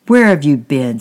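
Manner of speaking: loud, sharp demand from an annoyed stepfather; irritable stepfather. Acted. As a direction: bark the question at a boy who vanished. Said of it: "said the stepfather irritably".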